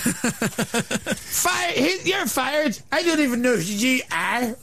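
hammed-up Northern Irish radio host voice; mock Northern Irish accent. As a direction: play a brash Belfast shock jock with a shaky Northern Irish accent. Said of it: "The accent's all over the place".

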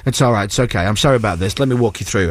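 low-pitched soothing reassurance; imitated placating guard. Second guard apologising and calming things, placating imitation.

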